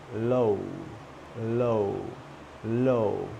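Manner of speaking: LOW drawn out with rounded lips. Shown as a demonstration. Teacher drilling the second syllable, LOW with rounded lips. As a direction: round your lips through the vowel of LOW. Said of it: "you round your lips".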